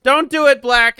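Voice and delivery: loud pleading shout; real loud warning shout. Real, not acted. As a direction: yell at the player to stop. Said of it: "what a fool".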